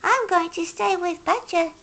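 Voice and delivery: high hedgehog call while running. A narrator's reading. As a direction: call back over his shoulder, high. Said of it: "he cried".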